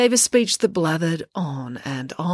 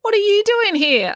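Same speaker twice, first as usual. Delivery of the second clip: high, loud startled question; astonished; 6 dB louder than usual. A narrator's reading. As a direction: blurt it out in disbelief. Finn startled to find the professor.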